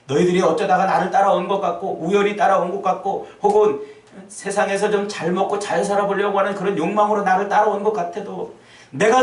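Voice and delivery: loud, high preaching as Jesus; impassioned Jesus. Acted. Jesus calling out to his disciples, loud and fervent. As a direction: proclaim it to the disciples with fervor.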